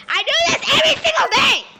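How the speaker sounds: high, fast frustrated scream at viewers; real scream. Real, not acted. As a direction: let it burst out in a loud, fast yell. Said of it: "I should not scream at my viewers".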